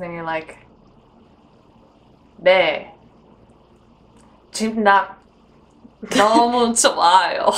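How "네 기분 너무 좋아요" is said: mock Korean, halting then fast; clumsy imitation of a Korean speaker; bad Korean accent. Girl mimicking her Korean date in a bad Korean accent. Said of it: "That's a really bad accent".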